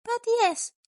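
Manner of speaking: a sly, chipper school principal asking; Nezu's voice. A narrator's reading.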